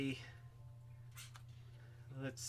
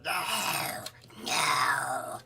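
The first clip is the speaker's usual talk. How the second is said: alien voice; slower than usual. Pretend. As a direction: play a strange alien saying no. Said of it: "That's my alien voice".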